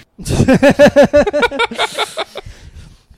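shrieking burst of laughter; big high-pitched laugh; loud high laugh. Real, not acted.

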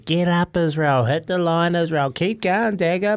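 high, hammed-up trainer calls; a trainer lightly imitated egging on a tired player; mock trainer encouragement. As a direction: cheer him on like a pushy trainer. Trainers yelling encouragement at him.

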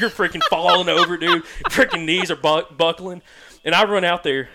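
laughs through the words; giggling at his own clumsiness. Real, not acted. Laughing to friends about falling over in booties.

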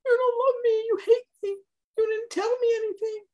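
high, teary accusation; mock crying wife. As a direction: play up the tears of a wronged wife. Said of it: "She's like".

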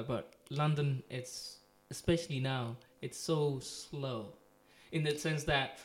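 normal talking voice

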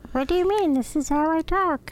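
very high, squeaky and innocent; bug voice. Retold or imitated. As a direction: stay in the tiny bug voice, playing dumb. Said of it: "you're still doing it".

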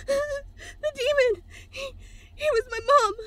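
tearful, shaken sobbing words; crying. Acted. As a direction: choke it out through tears. Bridget crying after the ordeal.

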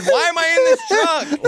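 high, slow confused whine. Retold or imitated. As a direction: play baffled, stretch it out. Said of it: "I would've said".

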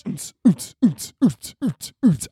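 punchy, high vocal techno thump; mouthed club beat. Pretend. DJ dropping the beat, mouthed bass thumps. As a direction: imitate a dance beat with the mouth.

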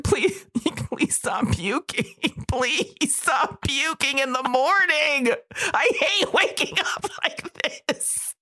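high, slow, laughing sob of a plea; mock weeping plea. Woman sobbing to her vomiting cat, high and slow. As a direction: wail it like you're crying into the cat's fur. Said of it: "I'm, like, crying into his head".

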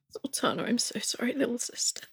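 hushed, tender in-character apology; soft, breath-only condolence; whispered consolation. Acted. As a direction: whisper it gently to a grieving sister. Character consoles grieving Zoltana.

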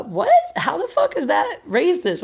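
high incredulous squeak into a laugh; disbelieving what? with a laugh; reliving total confusion; baffled. Retold or imitated. Kate baffled at the DM.

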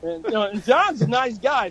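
ordinary delivery

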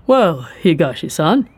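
slow, measured official voice; polished official. A narrator's reading. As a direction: draw it out calmly as a polished official. Ren speaking.

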